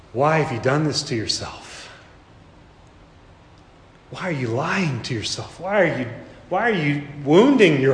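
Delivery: tender reproach. Acted. Jesus gazing at Peter who denied him, tender reproach. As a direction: ask it gently, grieving for him.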